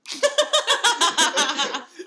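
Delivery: loud high burst of laughter. Real, not acted.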